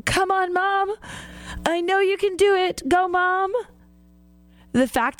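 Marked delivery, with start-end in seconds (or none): 0.0-3.5 put-on yelling little boy. Seven-year-old son cheering on his struggling mom, mock high-pitched yell. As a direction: imitate a small son urging his mom on. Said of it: "you can hear him yelling".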